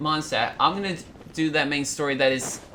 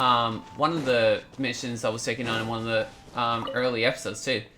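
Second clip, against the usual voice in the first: ordinary talk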